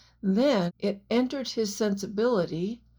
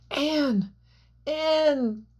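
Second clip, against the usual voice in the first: higher, anguished call; groaning; 6 semitones higher than usual. A narrator's reading. Jack groaning over Anne's body, anguished. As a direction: groan her name in despair. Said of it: "Jack groaned".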